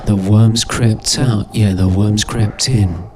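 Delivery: each word stretched slow and deliberate; unhurried spoken verse; slow poem recital. Acted. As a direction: recite it like a dark little rhyme.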